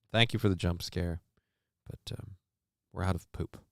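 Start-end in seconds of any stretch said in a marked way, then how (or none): none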